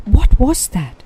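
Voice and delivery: high, loud frightened question; jolted by the rumble, scared; startled. Acted. A young koala after a terrifying rumble, high fearful cry.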